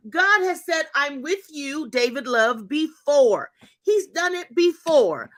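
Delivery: rising fervent preacher's exhortation; preaching. Acted. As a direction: preach it to the congregation with conviction. Preaching to her viewers.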